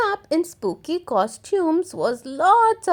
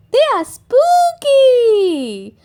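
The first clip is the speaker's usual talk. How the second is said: put-on high, slow, louder child voice; Peppa insisting; 10 semitones higher, 7 dB louder, slower than usual. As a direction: stretch it out, proud and spooky.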